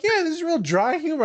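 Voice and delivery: high mock student voice; high, teasing student impression. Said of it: "They're like".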